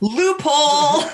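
high, drawn-out mock cheer; gleeful mock announcement. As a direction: crow it out high and long. Mock cheer at a silly excuse.

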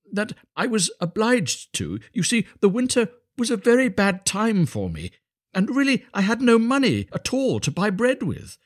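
high-pitched, apologetic little fellow; humble, earnest Hans. Acted. Hans confessing his hard winter to the miller, high and earnest. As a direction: explain earnestly in a high, innocent voice.

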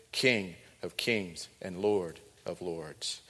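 each title drawn out very slowly; slow reverent proclamation. Acted. Preacher closing the Revelation reading, slow and reverent. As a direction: lay down each title with weight.

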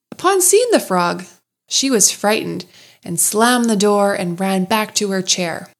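usual speaking voice

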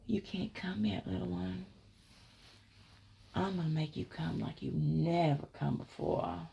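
soft, hushed voice in the ear; quietly retold intimate promise; retold whisper. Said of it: "whispering in my ear".

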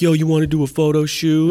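high, loud, eager invitation; excited pitch. Retold or imitated. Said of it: "now I'm all excited".

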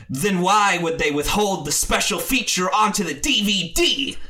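hammed-up indignant demand; indignant challenge. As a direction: imitate a self-righteous arguer. Acts partygoer's indignant challenge.

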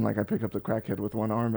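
normal talking voice

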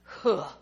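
imitated eye-rolling huff. Son rolls his eyes at his mother, mock dismissive huff. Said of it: "He rolled his eyes and went".

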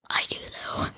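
eerie hushed creep voice; creepy whisper. Retold or imitated. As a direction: whisper it like a stalker. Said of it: "You sound like Cooper".

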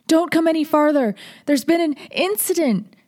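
put-on high, loud panicked boy's yell; frantic shout. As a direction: cry out in alarm, voice cracking. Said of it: "His adolescent voice cracked".

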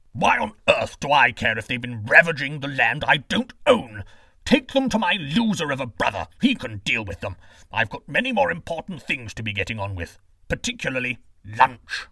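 loud, disdainful royal voice; a lazy, arrogant king brushing them off, loud; scornful king. Acted. King Amulius dismisses robbers.

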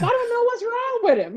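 high, laughing mock exasperation; laughing mock complaint. Mother mocking her old self about her son, high and laughing. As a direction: laugh through a mock eye-roll.

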